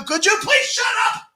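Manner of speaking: mock fed-up shout; exasperated plea. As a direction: beg him to stop talking. Inner plea to chatty stranger.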